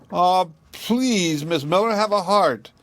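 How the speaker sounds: high, pleading whine; whining boy. A narrator's reading. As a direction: whine it like a boy begging for his ball. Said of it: "for he whined".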